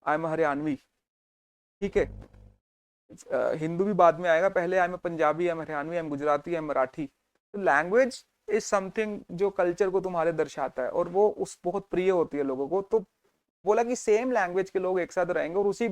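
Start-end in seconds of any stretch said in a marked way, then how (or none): none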